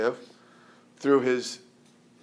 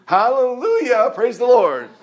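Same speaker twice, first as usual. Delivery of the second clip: much higher, louder hammed-up praise; 10 semitones higher, 9 dB louder than usual. Preacher mocking Christians who put on an air, hammed-up praise. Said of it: "put on this air of".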